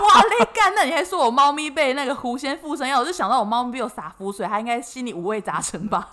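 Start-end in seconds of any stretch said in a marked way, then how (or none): 0.1-2.9 loud, high indignant outburst; outraged disbelief. Retold or imitated. Cheated client shouting at the fortune teller, loud outrage. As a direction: blurt out in shocked anger.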